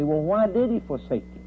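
usual speaking voice

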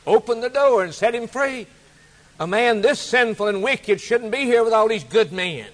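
king calling out to his guards to free the sinner; royal command. Acted. King orders guards to free man.

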